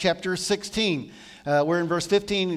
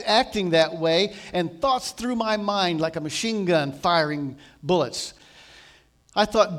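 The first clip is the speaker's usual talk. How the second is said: ordinary delivery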